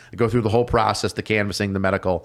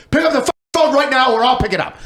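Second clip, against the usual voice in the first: higher and louder, rushed and pushy; insistent loud demand; 13 semitones higher, 4 dB louder, faster than usual. Retold or imitated. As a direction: bark it at him fast to make him answer. Friend insisting loudly.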